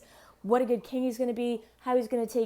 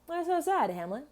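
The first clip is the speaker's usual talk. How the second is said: mock Claudius; 6 semitones higher than usual. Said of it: "Claudius is like".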